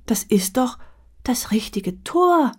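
slow, unvoiced whisper, faltering; a flustered woman stammering her excuse; hesitant whispered stammer. A narrator's reading. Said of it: "stammelte die Frau".

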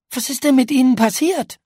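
high, loud startled question; shocked question. A narrator's reading. Paula asks the battered ghost what happened, startled and loud. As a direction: blurt it out in shock.

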